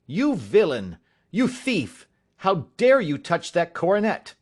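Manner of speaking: loud, high furious accusation; outraged scream. Acted. Father accusing his son of theft, loud and furious. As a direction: hurl each insult at the thief. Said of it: "I screamed".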